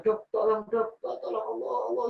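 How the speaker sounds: mock near-fainting cry for help, pitched high; faint plea. Man nearly fainting calls the doctor for help, imitated faint plea. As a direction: act out begging for help while about to pass out. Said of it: "hampir pingsan".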